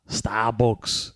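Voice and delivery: mock Liverpool accent; put-on Scouse accent. Shown as a demonstration. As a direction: say it like a playful Scouser. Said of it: "A Scouser".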